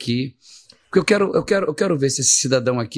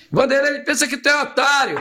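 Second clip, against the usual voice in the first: amused caller; 11 semitones higher than usual. Retold or imitated. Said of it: "um cara que tava na linha lá falou".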